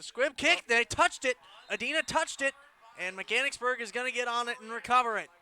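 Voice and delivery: rising excitement, loud and high; excited play call. Real, not acted. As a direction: call the surprise recovery like it's live. Surprise onside recovery call.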